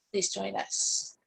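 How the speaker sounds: usual speaking voice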